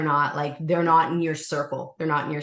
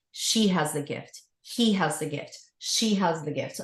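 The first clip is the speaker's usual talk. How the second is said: spirit guide voice. Retold or imitated. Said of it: "this man goes".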